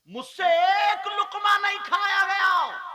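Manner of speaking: wife's anguished cry of regret. Acted. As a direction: burst out crying the words. Preacher voicing Hafsa's regret.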